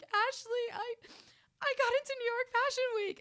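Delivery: very high, loud mock wail; retold sobbing. Tearfully telling older sister the big news, mock sobbing squeal. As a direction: re-enact blurting good news through sobs. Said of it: "I'm just sobbing".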